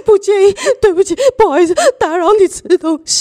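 high, apologetic politeness from a crying friend; bow and apologise with your voice; overly polite. Retold or imitated. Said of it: "他好有礼貌哦".

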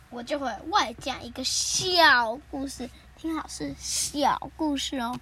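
小 drawn out long and punched; slow down and stretch each 小; stress on 小. Real, not acted. Host promises only a bonus story, stressing 小.

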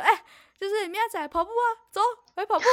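high, loud eager call to come run; excited call. Retold or imitated. Friend pulling her away, calling her high and eager.

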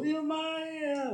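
high squealing oh-my-god, hammed up; mock panic. Retelling her panic over a client's call, high mock squeal. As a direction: shriek it like sudden alarm, played for the story.

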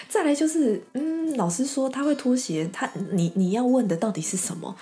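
ordinary talk

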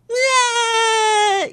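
high fake crying sob; mock crying wail. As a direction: wail like a little sister starting to cry. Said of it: "妹妹就开始". (假哭的高声呜咽)

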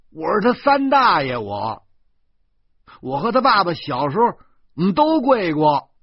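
high, loud indignant defence; blustering, worked-up retort; shouted self-justification. Acted. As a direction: yell your authority at them. Quarrel, defending the punishment.

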